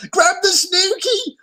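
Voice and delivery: very high called-out shout; bellowing. A narrator's reading. Seal and gnu bellowing to Abu, very high. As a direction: bellow it to the moat. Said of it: "bellowed the seal and the gnu".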